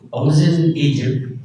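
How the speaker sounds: usual speaking voice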